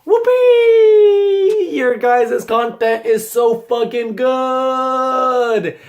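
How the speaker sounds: high, loud mock cheer, then slow; fake-cheer them, dripping sarcasm; sarcastic cheer. Mocking the big YouTubers with a sarcastic cheer.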